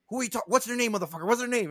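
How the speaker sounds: high, agitated mock interrogation; retold angry demand. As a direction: imitate a furious friend demanding answers. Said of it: "I've never seen Ahmad more angry".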